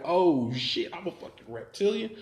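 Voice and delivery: high-pitched disbelief; stunned realization. Retold or imitated. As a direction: let it climb in shock at the discovery. Stunned discovery, retold.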